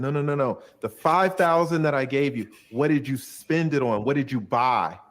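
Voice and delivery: the client pushes hard, demanding an answer. Retold or imitated. Client confronting his lawyer about the money, heated demand.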